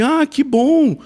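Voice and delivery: high-pitched, pleased exclamation; hammed-up delight; delighted reaction. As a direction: imitate a woman's happy surprise. Woman pleased he is a pastor.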